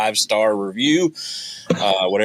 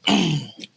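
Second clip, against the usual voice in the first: burst of laughter; 10 semitones higher than usual. Real, not acted. Host laughing at own joke.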